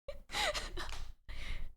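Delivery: a silent, airy burst of laughter; breathy laugh. Real, not acted. Streamer laughs at chat's age joke, breathless laugh.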